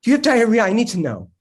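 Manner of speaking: loud urgent repeat; panicked. Acted. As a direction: insist on it, rattled.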